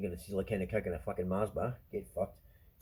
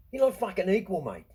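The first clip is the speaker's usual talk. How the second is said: higher and louder, heated; angry outburst; 8 semitones higher, 7 dB louder than usual. Real, not acted. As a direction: bark it at the room. Ranting against equality talk.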